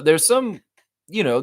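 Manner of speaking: normal talking voice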